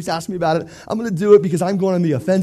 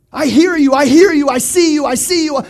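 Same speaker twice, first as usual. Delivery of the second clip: fervent prayer; 7 semitones higher, 6 dB louder than usual. Pretend. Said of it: "passionate prayer to God".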